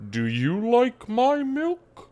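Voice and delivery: very slow, high-rising cow voice; slow mooing question from a cow; talking cow. Acted. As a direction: draw it out like a moo, climbing high. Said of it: "And Ree, you hear".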